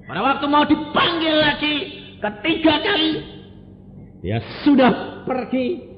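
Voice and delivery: loud, high, fast sermon cry; fiery preaching. Acted. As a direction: preach it out at full force. Preacher proclaims her death. (khotbah berapi-api dengan suara keras)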